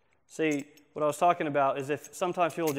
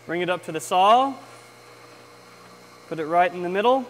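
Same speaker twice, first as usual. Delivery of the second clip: raised voice over loud machine; shouting over table saw; 3 semitones higher, 8 dB louder than usual. Real, not acted. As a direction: project over the whirring saw. Saw running, calling over noise.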